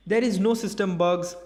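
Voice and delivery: ordinary talk, nothing marked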